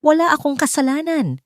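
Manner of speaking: shout. A narrator's reading. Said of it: "sigaw ni Sergio".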